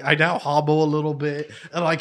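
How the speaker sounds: ordinary delivery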